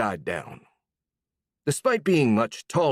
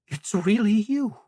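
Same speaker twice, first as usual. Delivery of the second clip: trembling voice; 11 semitones higher than usual. Acted. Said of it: "she said in a trembling voice".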